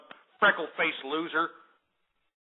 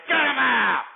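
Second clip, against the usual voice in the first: loud, high bark of dismissal; sudden explosive order; furious shout; 6 semitones higher, 6 dB louder, slower than usual. Real, not acted. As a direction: yell to have the caller cut off. Host orders caller cut off.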